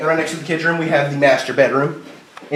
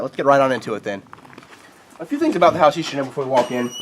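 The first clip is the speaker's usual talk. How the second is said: ordinary talk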